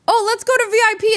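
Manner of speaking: high, quick mock-eager friend; eager suggestion. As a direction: pitch it up, rallying the group. Acted friend suggesting VIP.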